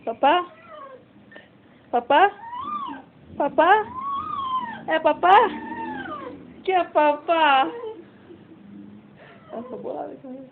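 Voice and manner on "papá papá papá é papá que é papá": higher, louder, rising coaxing calls; pet talk. Acted. Owner coaxing her dog Nicole with food, excited pet talk. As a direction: tease the dog with the promise of food.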